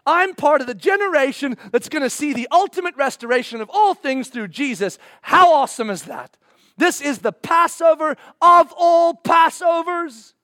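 loud, high-pitched triumphant sermon; full-force preaching. Acted. Preacher proclaiming the Passover of all Passovers, loud and rousing. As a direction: build it up and proclaim it to the crowd.

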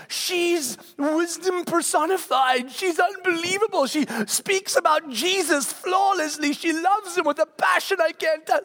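high, slow, starry-eyed gushing; imitating a counselor raving about his daughter; gushing praise. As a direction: rave like a devoted fan.

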